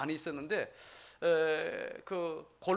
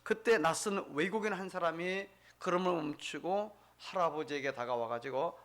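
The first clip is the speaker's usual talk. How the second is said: ordinary talk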